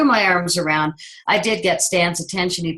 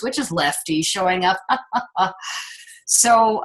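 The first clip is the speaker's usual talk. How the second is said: ordinary talk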